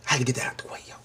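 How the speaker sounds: hushed gossipy whisper. Real, not acted. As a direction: lean in and whisper the juicy part. Teasing gossip aside.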